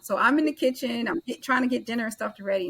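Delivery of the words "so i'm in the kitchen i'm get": usual speaking voice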